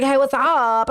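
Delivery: drawn-out UP falling at the end; playful camp greeting put on; imitating a brother's camp joke voice.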